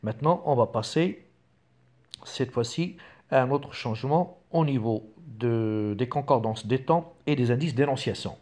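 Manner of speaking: ordinary delivery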